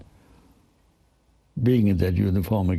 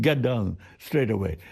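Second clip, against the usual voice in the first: laughing. Retold or imitated. Veteran laughs retelling the story.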